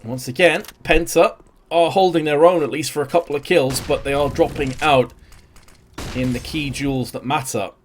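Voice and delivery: energetic esports caster calling the action; live play-by-play. Acted. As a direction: call every duel as it happens.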